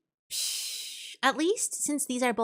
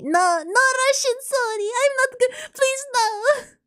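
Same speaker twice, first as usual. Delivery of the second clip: loud, high, playful wail; hammed-up panicked protest; 14 semitones higher, 9 dB louder than usual. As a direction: protest at the top of your voice, mock desperate. Comic protest against Russian character.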